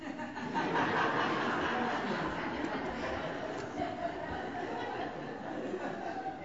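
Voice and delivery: silent, breathy laughter; a long unvoiced laugh. Real, not acted. Punchline laughter.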